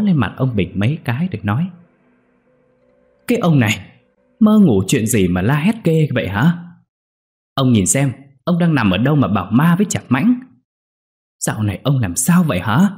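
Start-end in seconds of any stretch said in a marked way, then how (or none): 3.3-12.9 higher-pitched, exasperated wife; scolding. Acted. Wife scolding her husband after his nightmare, exasperated. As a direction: tell off the dreamer, baffled.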